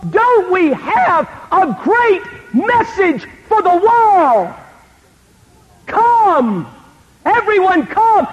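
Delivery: high, drawn-out evangelistic call; slow, soaring pulpit cry with stretched words; mock proclamation. Preacher sarcastically inviting the whole world, mock-grand proclamation.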